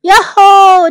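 high, very loud whoop; long, high cry of excitement; excited yell. A narrator's reading. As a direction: holler it with glee. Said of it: "汤姆大叫起来".

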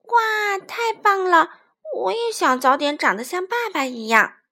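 put-on loud, excited little-child voice; childlike awe and delight; admiring baby dinosaur.

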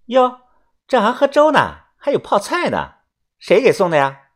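high, falsely pleasant jab; fake-smiling needling. A narrator's reading. Xiaoxuan spots the porridge and pickles, falsely sweet needling. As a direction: feign pleasantness with a high, catty edge. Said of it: "皮笑肉不笑的说啊".

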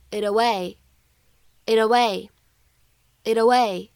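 very slow "it away" with the t flapped into "away"; linked flap t. Shown as a demonstration. Teacher slowly demonstrating American linking, flapped "it away". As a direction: say "it away" as "i-daway", drawn out. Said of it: "会连读".

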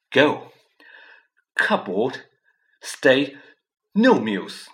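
loud, choked single words; furious, barely getting words out; strangled voice. A narrator's reading. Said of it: "so angry he could hardly speak".

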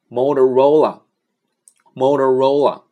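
slow, clear Motorola repeated twice; careful English name. Shown as a demonstration. Teacher modelling Motorola slowly for learners. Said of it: "叫".

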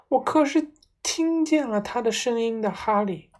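wife insisting to Harry; still trembling. A narrator's reading. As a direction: insist through the fear. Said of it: "妈妈仍旧在发抖".

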